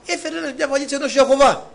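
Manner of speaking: a high, retold policeman's voice; policeman's reply. Policeman answers at the station.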